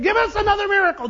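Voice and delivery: spoiled brat. Acted. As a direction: demand it like an entitled child. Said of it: "acting like spoiled brats".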